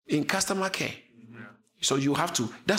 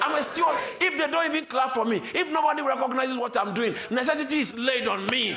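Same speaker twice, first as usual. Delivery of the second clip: high, ringing preacher's cry; fiery preaching; 8 semitones higher than usual. Acted. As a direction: preach it out with full fire. Preaching at full force.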